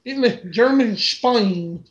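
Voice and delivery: hammed-up Southern US pronunciation; Southern accent. Shown as a demonstration. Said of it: "trying to do a Southern accent".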